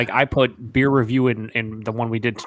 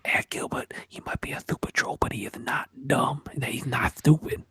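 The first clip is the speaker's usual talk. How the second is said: mock ASMR whisper; 5 dB quieter than usual. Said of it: "you gotta whisper".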